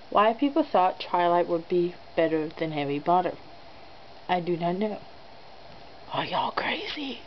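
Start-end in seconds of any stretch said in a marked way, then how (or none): 4.3-7.2 whisper. Acted. Incredulous aside to viewers.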